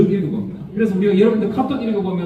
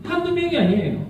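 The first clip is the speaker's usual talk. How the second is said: exasperated; 8 semitones higher than usual. Real, not acted. Exasperated telling, high.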